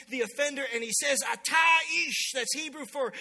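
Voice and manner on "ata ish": loud, high, drawn-out proclamation; slow, ringing shout of judgment; thundering accusation. Acted. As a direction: hurl the charge like a prophet. Prophet confronts the king, acted.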